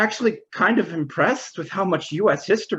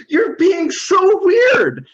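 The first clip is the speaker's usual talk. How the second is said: high, drawn-out cry of disbelief; acted scandalised shout; appalled outburst; 11 semitones higher, 4 dB louder, much slower than usual. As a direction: stretch it out in a high-pitched protest. Acted protest at the lewd question.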